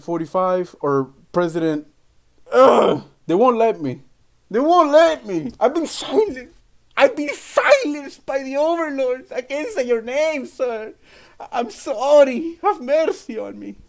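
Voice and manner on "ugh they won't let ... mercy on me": high, whiny mock plea of a silenced man; mock whimper. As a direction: beg for mercy like a hammed-up victim. Mock pleading about being silenced.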